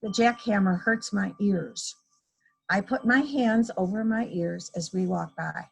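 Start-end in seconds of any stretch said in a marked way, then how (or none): none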